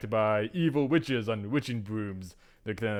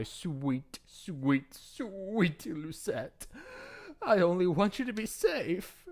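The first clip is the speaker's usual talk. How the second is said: high, soft, drawn-out endearments; syrupy motherly coo, rising higher; 7 semitones higher, 3 dB quieter, slower than usual. Acted. Mother wants sweet Lucette safe, high cooing.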